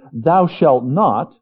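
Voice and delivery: slow, heavy, stern delivery; stern commandment. Acted. Preacher voicing God's stern old commandment. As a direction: lay down the law, word by word.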